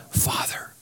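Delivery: breathy whisper of "Father". Shown as a demonstration. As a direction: say it softly like a prayer. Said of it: "How should we call him?".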